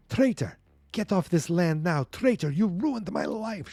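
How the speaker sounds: high-pitched accusation without real volume; mock scream. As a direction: act out a daughter screaming at her father, held back. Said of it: "She's screaming at him".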